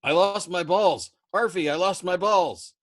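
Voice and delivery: shrieking at the co-pilot in terror; panicked scream. Acted. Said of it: "screamed at Arfie for help".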